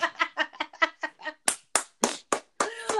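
squealing laugh with a snort; high snorting laugh. Real, not acted. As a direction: snort while laughing hard. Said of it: "get me to snort".

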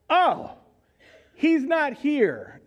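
high, slow alarmed realization; a parent's sudden gasp of worry; realizing in alarm. Retold or imitated. Parents realize Jesus is missing.

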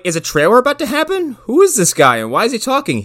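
high, incredulous questions; puzzled at the strange voice; baffled viewer. Retold or imitated. A confused viewer hearing the narrator, high and incredulous. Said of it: "I'm like".

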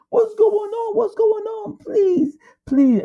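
a terrified woman begging at the door; frantic wife. Retold or imitated. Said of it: "His wife came out frantically".

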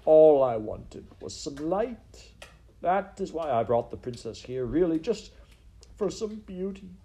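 high, swelling lament; tearful pleading. Acted. Crying rat confesses to the mouse, tearful pleading. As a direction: plead it out, halting and despairing. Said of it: "he began to cry".

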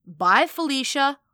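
loud, slow, sassy kiss-off; sassy dismissal. A narrator's reading. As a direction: dismiss them with sass.

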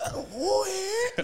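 long high wailed whoop; full-on rasp. Retold or imitated. Retelling the two of them rasping over a smoke, high wail. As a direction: holler it out, really going for it. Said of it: "Like, really".

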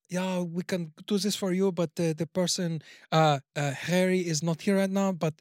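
put-on French-accented phone receptionist; imitated French accent on the phone. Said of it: "she clearly sounded French".

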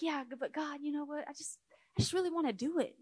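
mock whiny excuse to God; whiny pleading. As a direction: plead with God like a reluctant child.

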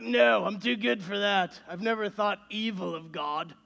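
a mock prim British accent. Pastor voices a self-righteous churchgoer in a mock British accent. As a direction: put on a British accent, half joking. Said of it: "I don't know why you're British all of a sudden".

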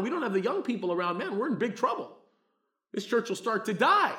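loud, high, driving sermon delivery; preaching at full force. Acted. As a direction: preach it hard to the congregation.

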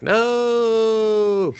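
long, high, melodramatic cry; mock anguished wail. Heroine discovering her frozen sword, mock anguished wail. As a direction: hold the vowel in hammed-up despair.

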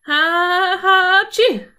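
loud, high build-up into a sneeze; acted sneeze. A narrator's reading. Boy in the barrel can't suppress it, loud sneeze. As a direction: let a held-back sneeze burst out. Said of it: "konnte das Niesen nicht länger unterdrücken".

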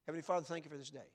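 quick, automatic opening of a prayer; rote prayer. Shown as a demonstration. Preacher mimicking people who start prayers by habit, rote and quick. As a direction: rattle it off by habit. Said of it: "And away you go".

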